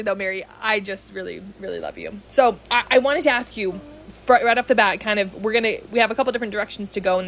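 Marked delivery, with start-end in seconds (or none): 0.0-2.2 soft, lowered, tender voice; sincere affection. Real, not acted. Host telling her guest she loves her, softly sincere. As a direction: drop from the joking into quiet heartfelt affection.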